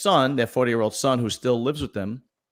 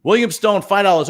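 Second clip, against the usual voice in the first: high, loud, cheerful callout of a donor's name; excited shout-out; 10 semitones higher, 8 dB louder than usual. Real, not acted. Host calling out a five-dollar donor, excited. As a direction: announce the tip like a host thrilled by it. Reading out a viewer donation.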